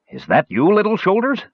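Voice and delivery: high-pitched questioning call. A narrator's reading. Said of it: "he called out".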